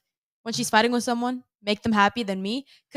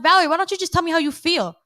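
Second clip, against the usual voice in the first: exasperated plea; 6 semitones higher, 6 dB louder than usual. Retold or imitated. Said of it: "'Cause I'm like".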